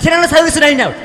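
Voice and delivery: loud, high joking shouts; playful shouting. Real, not acted. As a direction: toss out silly names, shouting. 名前案を叫んでふざける. (ふざけて大声で叫ぶ)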